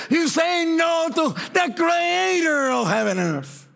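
booming preacher voice of God scolding; God scolding a stubborn child, voiced from the pulpit; God rebuking. Preacher voicing God's rebuke.

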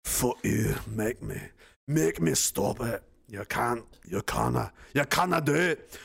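defiant put-on Scottish brogue; Scottish accent. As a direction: taunt the critic as a stubborn Scot. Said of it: "Ian hates my Scottish accent".

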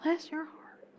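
soft, sweet Southern "Bless your heart"; syrupy Southern charm; genteel Southern lady; Southern gentility. Shown as a demonstration. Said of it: "all the Southern gentility".

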